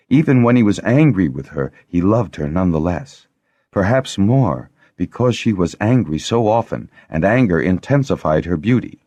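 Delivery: ordinary delivery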